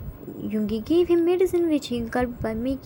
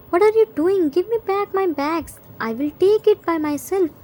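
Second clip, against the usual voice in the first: higher, mildly indignant protest, loud at first; a touch of outrage in a higher voice; indignant demand; 4 semitones higher, 4 dB louder than usual. A narrator's reading. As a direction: demand it back, pitched up. Jimin demands his bags back.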